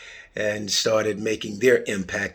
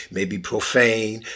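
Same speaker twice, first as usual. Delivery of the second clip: usual speaking voice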